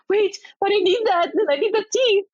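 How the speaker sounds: high whiny plea. Retold or imitated. Retelling idol pleading, high pitch.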